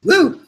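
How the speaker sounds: sharp high whoop from the garlic burn; high burning-mouth whoop. Real, not acted. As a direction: let out a hot-mouth woo. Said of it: "my mouth is on fire".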